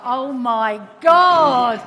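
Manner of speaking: loud, slow laughing outburst; laughing exclamation. Real, not acted. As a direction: burst out laughing in disbelief. Realising colleague's mic is live.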